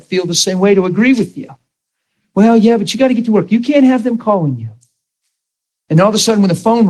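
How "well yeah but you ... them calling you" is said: higher, quick know-it-all voice; a higher, brisk voice of easy worldly advice; mock friend's advice. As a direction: toss it off like a friend who sides with you.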